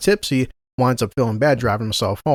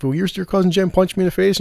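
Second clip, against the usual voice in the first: higher-pitched tearful imitation; mock crying; 7 semitones higher than usual. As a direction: imitate a whiny, crying complaint. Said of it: "crying, stuff".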